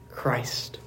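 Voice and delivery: whispered "Christ" at the end of the line. Real, not acted. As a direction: drop to a reverent whisper. Sermon climax, hushed final word.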